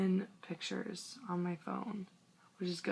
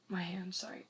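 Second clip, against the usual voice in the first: whisper; 8 dB quieter than usual. Real, not acted. Apologizing to camera aside.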